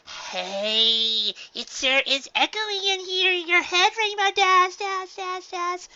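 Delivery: very high, bouncy, loud cartoon voice; Pinkie Pie's voice. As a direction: play with the echo gleefully. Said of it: "Pinkie said".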